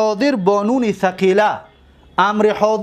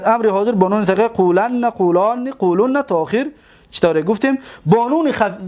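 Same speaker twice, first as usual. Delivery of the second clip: ordinary delivery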